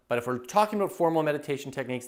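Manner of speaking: usual speaking voice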